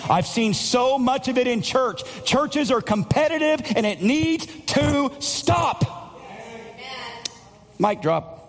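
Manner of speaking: pitched high and driving, preaching hard; full-force preaching. Real, not acted. Pastor rallying the church against competition, full-force preaching.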